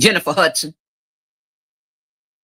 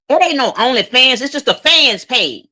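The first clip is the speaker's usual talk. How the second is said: high, mocking mother's excuse; mock mama; 9 semitones higher, 4 dB louder than usual. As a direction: imitate the mama brushing it off. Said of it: "The mama said".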